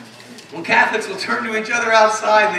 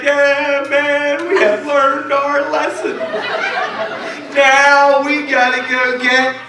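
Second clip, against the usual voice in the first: fake remorseful drunk; 7 semitones higher, slower than usual. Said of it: "And you look real sad, like".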